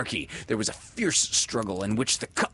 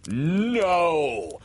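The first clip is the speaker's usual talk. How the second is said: scornful, drawn-out no, pitched higher; 6 semitones higher, 3 dB louder than usual. A narrator's reading. Peter denies being a man, ringing out scornfully. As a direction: ring out the denial with scorn. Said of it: "answer rang out scornfully".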